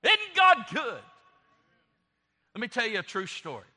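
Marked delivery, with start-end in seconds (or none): 0.0-1.0 loud, high, slow preacher's cry; exultant exclamation. Real, not acted. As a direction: exult slowly, pitched way up.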